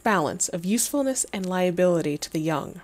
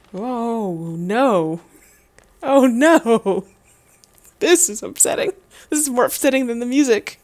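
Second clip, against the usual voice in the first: high, loud cry of dismay; dismayed reaction; 4 semitones higher, 6 dB louder than usual. Real, not acted. Reacting to something more upsetting than the music, loudly dismayed.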